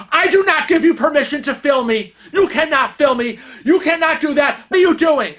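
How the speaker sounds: imitating an angry yell, high and rapid-fire; retold scream. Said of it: "He starts screaming".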